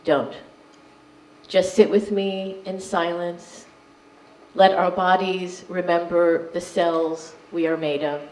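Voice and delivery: measured poetry reading with deliberate pauses; poem reading. Acted. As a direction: read each line out with weight. Reads poem to audience.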